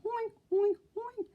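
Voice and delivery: high snorted piggy oinks, fading; snorting oinks. Acted. Pinky chomping roast beef, high oinks. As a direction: snort like a greedy pig. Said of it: "snorted Pinky".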